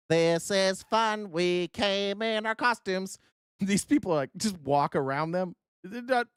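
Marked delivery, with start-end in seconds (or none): 0.1-3.2 slow, sing-song mock voice; mocking protester voice. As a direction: drawl it like a bored protester. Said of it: "voicing protesters".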